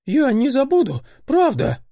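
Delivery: high, pleading promise; earnest boy voice. A narrator's reading. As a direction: promise it eagerly, near tears.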